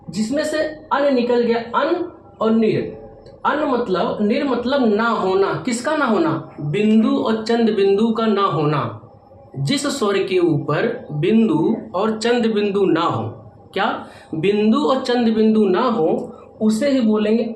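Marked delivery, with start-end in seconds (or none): none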